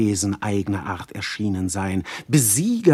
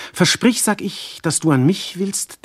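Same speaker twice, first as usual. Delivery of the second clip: urgent plea; 8 semitones higher than usual. Acted. Lover demanding Alkmene's promise, high and urgent.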